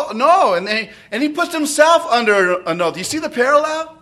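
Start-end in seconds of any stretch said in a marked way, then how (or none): 0.1-0.5 loud, higher panicked no; loud denial. Acted. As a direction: blurt the denial loudly. Peter denies again.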